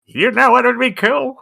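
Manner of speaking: mock-inspired inventor, lightly acted; mock scientist father. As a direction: pitch it up like a sudden bright idea. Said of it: "scientist father ... was like".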